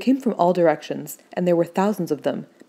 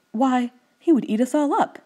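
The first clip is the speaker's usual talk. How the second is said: high, alarmed protest; alarmed cry; 7 semitones higher, 3 dB louder than usual. A narrator's reading. Little Queen on hearing of a lion, alarmed cry. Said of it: "cried the little Queen".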